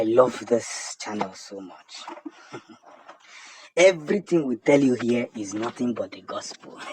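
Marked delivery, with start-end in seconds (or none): 2.5-6.8 laughing. Real, not acted. Laughs while boasting about channel.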